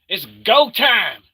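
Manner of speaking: slow loud hype call. Acted. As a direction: stretch out the hype line.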